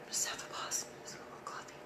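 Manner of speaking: breathy hushed whisper; whispered aside. Real, not acted. Calming herself down with coffee, whispering. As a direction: drop to a whisper for a coffee break. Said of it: "I just need to pause".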